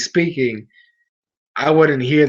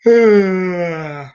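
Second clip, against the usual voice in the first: sigh; 4 semitones higher, 5 dB louder than usual. Real, not acted. Big sigh at stream end.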